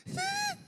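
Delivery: very high, thin whimper; mock near-crying squeal. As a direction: act out tears welling up. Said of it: "I ain't wanna cry, but I, I'm starting to feel that".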